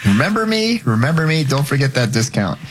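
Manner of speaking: high, teasing sing-out; jokey high-pitched reminder, easing down; mock repeat customer. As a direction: playfully pipe up for attention, pitch starting high. Jokingly voices a repeat discount customer.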